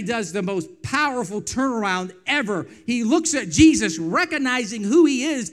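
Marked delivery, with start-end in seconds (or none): none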